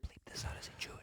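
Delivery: hushed whisper so the mic misses it. Real, not acted. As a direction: drop to a breathy secret whisper. Asking not to be recorded.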